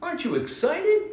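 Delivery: very high, eager bird question; excited bird. Acted. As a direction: coax the grumpy rabbit, bird-high. Bird coaxing Rabbit.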